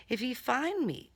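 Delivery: plain reading voice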